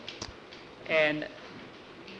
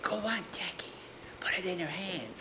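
urgent higher whisper; 4 semitones higher, 10 dB quieter than usual. Acted. Skeeter urges Jackie secretly in a whisper. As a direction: hiss it under your breath.

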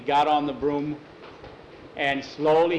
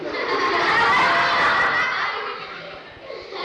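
long, high-pitched witch cackle; shrieking storybook witch laugh; 3 dB louder than usual. Acted. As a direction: cackle high and let it run on.

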